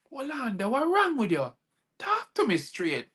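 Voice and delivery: high, worried plea; pleading. Retold or imitated. Husband pleading with his furious wife, high and worried.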